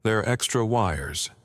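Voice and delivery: ordinary delivery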